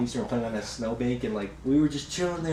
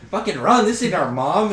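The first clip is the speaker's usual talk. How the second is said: high, loud retold panic; alarmed shout; 7 semitones higher, 7 dB louder than usual. Kid warns friends to flee strange woman, alarmed shout. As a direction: yell it as a warning to bolt.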